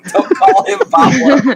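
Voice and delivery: high, loud laughing objection; cracking up mid-protest. Real, not acted. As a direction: laugh while you object.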